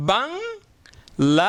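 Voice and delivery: "বাংলাদেশ" in three slow parts, বাং, লা, দেশ; split into syllables. Shown as a demonstration. Teacher showing the country's name has three syllables, "বাংলাদেশ" stretched part by part. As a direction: draw out "বাংলাদেশ" with a break before দেশ. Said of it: "তিনবার উচ্চারণ".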